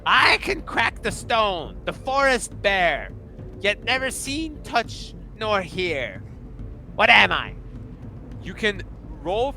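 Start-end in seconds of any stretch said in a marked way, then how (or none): 0.1-7.6 high, loud, slow riddling shout; a mad little king reciting a riddle; kobold king riddle. Acted. The king poses his riddle to the party. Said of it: "He walks up and goes".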